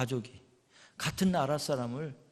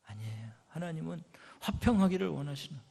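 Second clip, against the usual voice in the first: ordinary talk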